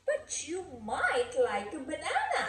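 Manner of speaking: plain reading voice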